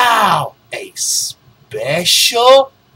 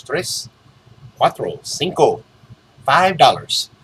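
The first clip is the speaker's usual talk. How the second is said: ordinary delivery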